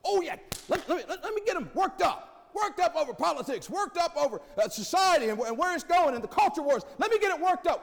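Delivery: high, loud, gloating devil voice; mock Satan voice. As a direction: ham up a scheming Satan stirring trouble. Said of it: "He loves it".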